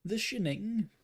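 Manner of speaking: "Shining" said as "Shinning", high-pitched; joke mispronunciation. Pretend. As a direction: say "Shining" with a short i, like "shin". Joke mispronunciation of film title.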